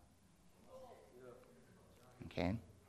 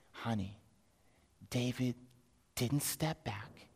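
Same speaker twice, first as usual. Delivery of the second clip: hushed awe; 4 semitones lower, 13 dB quieter than usual. Acted. Father telling mother about David, hushed awe. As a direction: break astonishing news in a hush.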